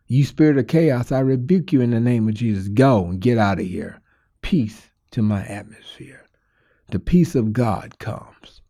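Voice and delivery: firm, rebuking prayer easing to a whisper; spiritual warfare prayer. Acted. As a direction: rebuke the spirit, then hush.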